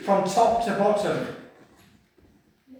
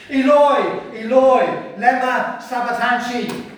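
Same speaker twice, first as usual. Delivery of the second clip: high, full-voiced shout; loud, desperate calling out; loud cry; 7 semitones higher, 10 dB louder than usual. Shown as a demonstration. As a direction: cry it out in anguish. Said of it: "he cried out in a loud voice".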